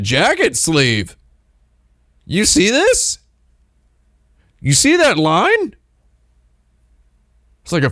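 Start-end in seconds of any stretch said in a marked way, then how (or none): 2.2-5.7 high, excited pointing out; excited admiring. Real, not acted. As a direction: squeal over the perfect line. Admiring jacket sleeve line.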